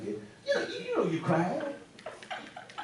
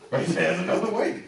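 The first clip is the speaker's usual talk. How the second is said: laughing; 8 semitones higher, 7 dB louder than usual. Acted. The preacher laughs while repeating Peter.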